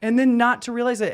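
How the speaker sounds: exasperated disbelief. Real, not acted. Exasperated at his own forgetfulness.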